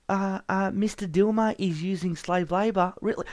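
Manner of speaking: a nervous clerk handling a caller; Ben Tover's voice. Acted. Ben takes one more call.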